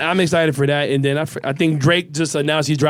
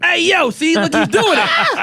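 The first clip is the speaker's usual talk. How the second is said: excited laughing call-out; 12 semitones higher, slower than usual. Real, not acted. Catching friend doing creepy thing.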